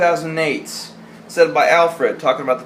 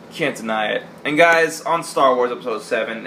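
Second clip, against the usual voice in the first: normal talking voice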